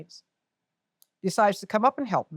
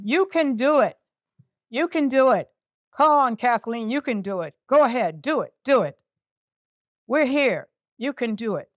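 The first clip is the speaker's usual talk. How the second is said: imitated calls of encouragement, raised voice; cheering on; 4 semitones higher, 4 dB louder than usual. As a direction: urge a struggling climber on from below. Voices people cheering her on.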